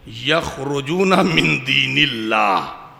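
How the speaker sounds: a deliberately misread verse, یخرجون drawn out slowly; slow chanted misreading; slow Quranic recitation. Shown as a demonstration. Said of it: "صحیح ہے یا غلط ہے؟".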